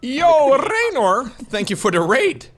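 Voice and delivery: loud, high hype welcome; excited greeting. Real, not acted. Streamer thanks a raiding channel, loud and high.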